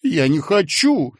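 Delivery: tearful, high protest; whiny cry. A narrator's reading. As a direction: wail it like a child refusing. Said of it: "плаксиво вскричал Коротков".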